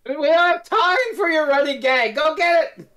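high, loud exasperated voice; high impatient Romana. Retold or imitated. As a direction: snap at him, fed up.

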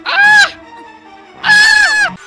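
high-pitched, short screams; two sharp screams under attack; loud scream. Real, not acted. Screams during the chase.

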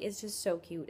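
ordinary talk, nothing marked

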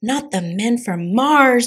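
stretched-out theatrical outcry; dramatic declaration. A narrator's reading. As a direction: declare it with melodramatic alarm. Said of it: "declared Scapito".

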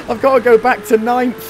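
helpless laughter, high and loud; real laughing. Real, not acted. Player laughing at being sent back to ninth, high and loud. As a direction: laugh your way through the words. Laughing at own race blunder.

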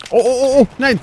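loud, high panicked oh oh; panicked cry. Real, not acted. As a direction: panic as the enemy appears. Panicking under fire. (panisches Oh, laut und hoch)

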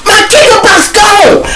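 high, loud panicked yell about the box; frantic yell. Retold or imitated. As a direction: yell it out like everything's lost. Friend yelling from police lot.

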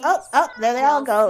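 ordinary talk

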